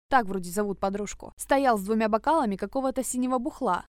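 ordinary delivery

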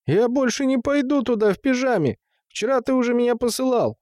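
put-on high-pitched whiny refusal of a child; balking boy. A narrator's reading. Boy refuses his father's errand, balking. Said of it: "заортачился мальчик".

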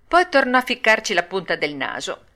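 plain reading voice

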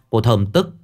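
plain reading voice

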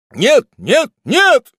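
very high, loud, desperate denial; pleading, high-pitched shouts; frantic cry. Acted. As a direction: cry out in panic. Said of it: "закричал бедный юноша".

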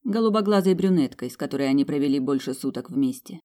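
ordinary delivery

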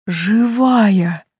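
glad, breathless cry; joyful gasp. Acted. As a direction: gasp it out with joy. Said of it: "радостно ахнули несколько голосов".

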